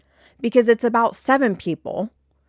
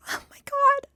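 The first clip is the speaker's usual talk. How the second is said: much higher, pitched-up disbelief; exasperated oh my god. Real, not acted. Fed up with coworkers, high exasperated cry. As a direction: throw it up in real exasperation. Exasperated outburst mid-rant.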